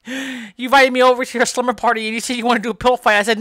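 normal talking voice

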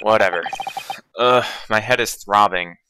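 normal talking voice

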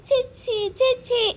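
put-on high, whimpering toddler calling for mummy; baby girl voice. Little girl calls mummy from the bathroom, whiny baby voice. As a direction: babble like a tiny child with giggles and little cries.

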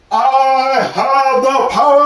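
high, loud, slow heroic bellow; cartoon hero shout. He-Man cartoon catchphrase shouted.

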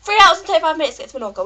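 fast, high outraged echo; incredulous repeat. Retold or imitated. Passenger reacting to long Menorca flight, fast incredulous repeat. Repeats announced time in disbelief.

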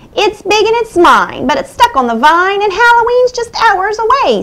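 high, fast witch voice. A narrator's reading. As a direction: squawk it like a cackling witch. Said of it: "said the witch".

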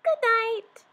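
very high tiny squeaky voice; mouse squeak. Acted. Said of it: "the mouse squeaked".

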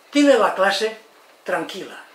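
ordinary talk

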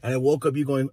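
ordinary talk, nothing marked

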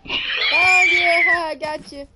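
shouting loud and high; triumphant shout. Real, not acted. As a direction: yell it out in triumph. Found friend hiding.

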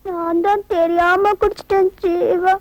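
very slow, drawn-out words; acted faint, stretched-out delivery; woozy confession. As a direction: drag it out as if poisoned and sinking. Confesses drinking the tainted honey.

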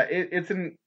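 ordinary talk

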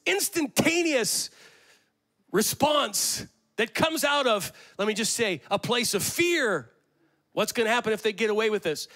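high, loud passionate sermon; preaching at full force. Acted. Pastor naming fear and anger, full-force preaching. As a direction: hammer each emotion home.

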